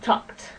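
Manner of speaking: "talked" whispered, with the unvoiced t clear; unvoiced "talked", to show there is no vibration; whispered model word. Shown as a demonstration. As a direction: breathe out "talked" without voice. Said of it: "we say".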